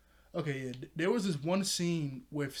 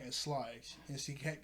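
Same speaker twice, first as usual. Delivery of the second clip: normal talking voice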